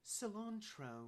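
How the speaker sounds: CILANTRO drawn out slowly in a put-on British accent. Shown as a demonstration. Asked to say it British, in a put-on British accent. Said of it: "say cilantro in a British accent".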